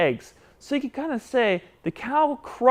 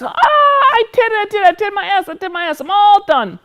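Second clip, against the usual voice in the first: high, loud triumphant puppet squeal; excited; 13 semitones higher, 5 dB louder than usual. Pretend. Puppet celebrating his first S, high excited squeal. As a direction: crow with delight in puppet voice.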